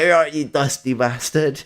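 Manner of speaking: a touch of playful name-calling; mate's greeting. Retold or imitated. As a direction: call it out like a cheeky mate.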